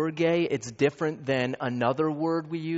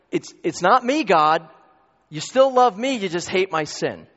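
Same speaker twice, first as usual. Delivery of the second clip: pleading sinner; 5 semitones higher, 7 dB louder than usual. Retold or imitated. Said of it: "we go into this like".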